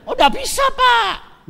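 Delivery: seller's shouted second no; shouted refusal. Retold or imitated. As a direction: shout the no right back at him.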